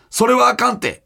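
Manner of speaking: awkward Kansai dialect. Retold or imitated. Host quoting a listener's complaint about a comedian, awkward Kansai dialect. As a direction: imitate someone's unconvincing Kansai dialect. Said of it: "違和感のある関西弁を喋っていました".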